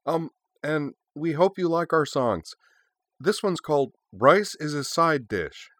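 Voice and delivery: high, hesitant schoolgirl; timid, sweet song intro; shy girl voice. Acted. Yui shyly introducing the song.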